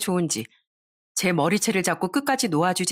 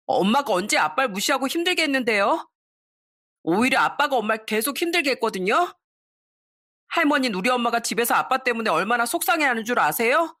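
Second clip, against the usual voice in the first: put-on boy talking back; 4 semitones higher than usual. A narrator's reading. Son scolding grandmother.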